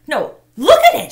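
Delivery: loud high scream. A narrator's reading. Girl screams at boy to look at her hand. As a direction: scream it at him. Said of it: "she screamed".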